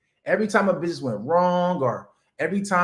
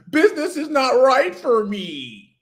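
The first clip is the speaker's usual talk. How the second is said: high, slow whining voice; hammed-up whiny quitter; mock whiner; 9 semitones higher, 4 dB louder, slower than usual. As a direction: ham up a complainer. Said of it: "if you look at money".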